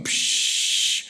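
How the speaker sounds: imitated hiss of gas from a burner knob; breathy psh like a gas valve opening. Imitating gas hissing from the grill.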